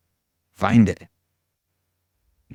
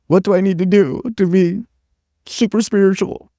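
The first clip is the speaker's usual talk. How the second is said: high, loud, put-on voice; hammed-up eager believer, pitched way up; mock eager seeker; 10 semitones higher, 6 dB louder than usual. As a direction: play a gullible devotee in a loud, squeaky-high voice. Mocking his own eager gullibility.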